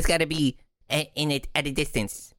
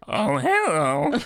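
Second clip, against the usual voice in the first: nerdy voice; 3 semitones higher, much slower than usual. Acted. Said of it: "Try not to sound like a nerd".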